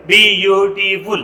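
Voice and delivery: بیوټیفول slowly split as بی-یو-ټی-فول; syllable by syllable. Shown as a demonstration. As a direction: count out the syllables of بیوټیفول, drawn out. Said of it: "دا څلور". (بیوټیفول ورو، سیلیبل په سیلیبل)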